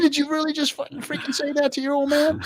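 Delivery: high, hammed-up disbelief; mock offended dad. Dad retells his disbelief at his son, high hammed-up voice. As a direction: act out an outraged dad. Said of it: "I'm like".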